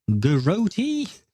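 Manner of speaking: "Garoti" as guh-ROH-tee, drawn out and rising high; disbelieving question on "Garoti", ending in a long TEE; incredulous pronunciation. Shown as a demonstration. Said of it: "That's how you pronounce that word?".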